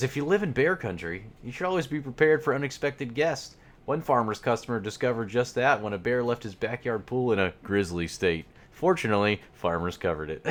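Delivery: playful ad-read voice. Acted. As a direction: read it like a cheesy insurance ad. Reading ad copy aloud.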